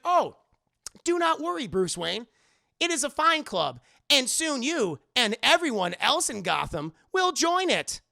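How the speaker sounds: high, loud, measured robot reassurance; stilted, over-enunciated robot sales pitch; robot mayor. Acted. Robot mayor promises Bruce the club is fine, loud and stilted. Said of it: "Like Robo Mayor Hill".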